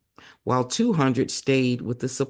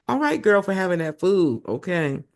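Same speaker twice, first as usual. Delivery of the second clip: excited cheer; 5 semitones higher than usual. Real, not acted. Aside cheering Abigail.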